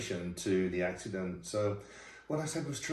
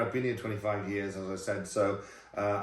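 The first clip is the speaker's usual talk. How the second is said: ordinary talk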